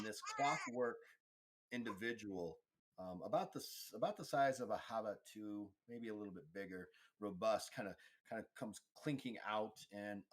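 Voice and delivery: ordinary talk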